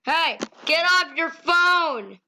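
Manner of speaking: scolding call. Real, not acted. Player to neighbor on his phone, scolding call. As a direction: call out to get someone's attention.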